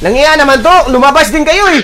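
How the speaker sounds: high-pitched heated complaint; exasperated outburst. Real, not acted. Gamer telling teammates to come out, exasperated.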